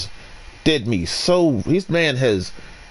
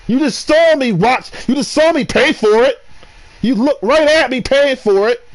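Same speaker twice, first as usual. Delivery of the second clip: pitched-up exasperation; indignant rant; 8 semitones higher than usual. Real, not acted. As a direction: vent the outrage upward. Ranting at clerk.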